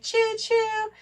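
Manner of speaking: high playful train call; a little girl playing train; child's choo choo. Mother imitates Eleanor playing train on the rug, high and playful. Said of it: "She's like".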